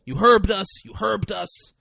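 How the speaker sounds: mock yelled herbed. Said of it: "what that means".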